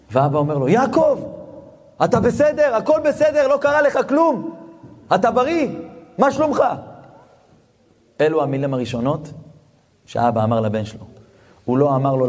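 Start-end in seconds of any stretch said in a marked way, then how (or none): 0.7-6.6 anxious father's questions, pitched higher; a relieved, worried dad checking his son is safe. Retold or imitated. Father on the phone to his runaway son, anxious and caring, higher.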